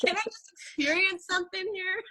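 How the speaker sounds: high, half-laughing, frustrated plea; begging through laughter. Retold or imitated. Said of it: "And I'm like".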